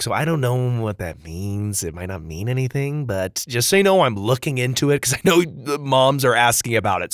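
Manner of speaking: usual speaking voice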